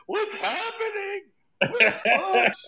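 mock confused, high and laughing; high laughing confused stage questions. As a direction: play a lost comic, giggling. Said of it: "mock confused comic on stage".

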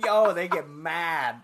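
normal talking voice

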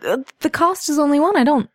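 a hint of a nervous shop girl; polite shopkeeper voice.

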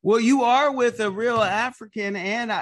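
loud, high-pitched hype for the guest; emphatic encouragement. Real, not acted. Host hypes guest to talk freely.

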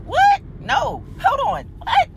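very high, startled yelps; can't believe what he said; shocked yelp. Retold or imitated. To her partner, high shocked yelps. Retold shock at his answer.

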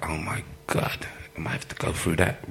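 hushed inner voice; whispered dread. Retold or imitated. Thinking to himself after his debut, hushed and dreading. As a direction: breathe it like a scared private thought.

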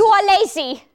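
put-on high-pitched scolding in a German accent; forceful German accent. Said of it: "that sounds German to me".

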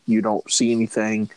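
ordinary talk

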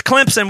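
Clemson pronounced "Clempson". Shown as a demonstration. Correcting outsiders on the local name, Clemson with a P. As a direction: slip a P before the S in Clemson. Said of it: "with a P".

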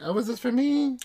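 high, sing-song housekeeper asking sweetly; imitating Marlene. Housekeeper eyeing the food, high mock voice. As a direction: play the housekeeper spotting the food. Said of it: "and she's like".

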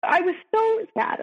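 upset, voice rising as the sadness returns; real sadness in voice. Real, not acted. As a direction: hold back tears while telling it. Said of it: "you could hear it in my voice".

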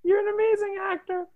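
high, squealing fan voice; gushing fan. Acted. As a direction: fawn over the star breathlessly.

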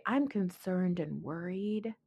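soft, slow mock concern; feigned concern. Woman masking an attack as care, quietly concerned. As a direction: feign worry, drawn out and hushed. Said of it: "mask something in care and concern".

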